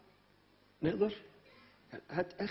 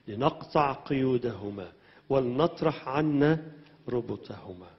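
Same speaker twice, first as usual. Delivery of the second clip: measured psalm reading, building louder; scripture reading; 8 dB louder, much slower than usual. Acted. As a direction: read the psalm aloud to the church. Reads psalm to congregation. (قراءة المزمور بصوت مرتفع)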